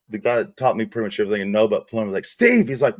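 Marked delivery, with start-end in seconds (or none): 2.3-2.7 high call of a name across the job site; yelling for the coworker after the injury. Retold or imitated. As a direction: call Steve's name sharply upward. Calling out again.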